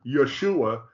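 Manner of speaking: YESHUA as yuh-SHOO-uh with middle stress; their pronunciation. Shown as a demonstration. Teacher repeating their claim, YESHUA pronounced yuh-SHOO-uh. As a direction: hit SHOO in YESHUA, let the ending drop. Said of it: "pronounced their way".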